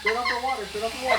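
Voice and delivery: high urgent repeated command; urgent call. Real, not acted. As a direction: call out to stop the water. Water spraying, urgent command.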